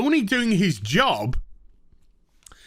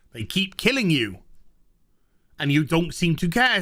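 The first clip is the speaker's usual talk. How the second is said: usual speaking voice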